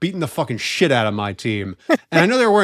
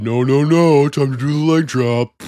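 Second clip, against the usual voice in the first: louder wrestler promo voice; Hulk Hogan; 5 dB louder than usual. Retold or imitated. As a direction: bellow it like a pro wrestler. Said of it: "like Hulk Hogan".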